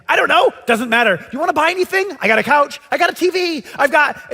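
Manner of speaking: high, loud rapid sales pitch; eager selling. Retold or imitated. Man pushing his belongings on friends, high and loud. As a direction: hawk your stuff eagerly.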